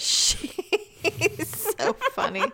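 drawn out, very slow words through laughter; laughing. Real, not acted. Friend cracks up at the cat story, slow laughing words. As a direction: laugh through the whole line.

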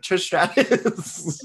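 high, drawn-out blurted bluff; mock bluffing answer. Pretending to be Canadian when quizzed, high mock bluff. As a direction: stretch it out high as a hopeless guess.

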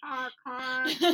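imitated car horn; taxi horn honks. Podcaster adding a taxi cab to the homemade intro, honking. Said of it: "That's a taxi cab honking".

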